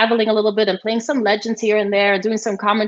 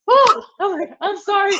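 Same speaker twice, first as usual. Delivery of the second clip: high-pitched mock sheepish sorry; comic high-voiced apology; retold startled apology; 10 semitones higher than usual. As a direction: reenact being taken aback, voice pitched up. Retells startled apology to opponent.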